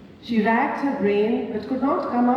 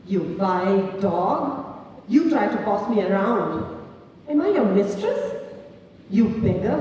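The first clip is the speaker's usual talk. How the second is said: woman throwing out her pimp with biting scorn; 6 semitones higher than usual. A narrator's reading. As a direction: spit each insult at him. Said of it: "responded in a biting tone".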